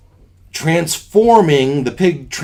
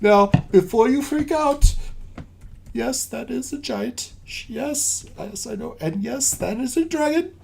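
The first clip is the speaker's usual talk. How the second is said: higher captain voice, placating; 10 semitones higher than usual. Acted. Captain explains to Fendrel.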